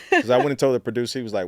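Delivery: ordinary delivery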